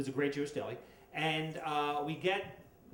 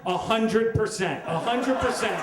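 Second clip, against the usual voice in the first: laughing emphasis; 6 semitones higher, 8 dB louder than usual. Real, not acted. Comic gleefully tells crowd, laughing loud. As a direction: laugh as you punch it twice. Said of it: "laughing emphatic answer".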